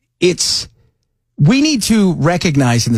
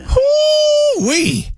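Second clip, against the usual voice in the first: excited whoop. Real, not acted. Cheering the vote count live.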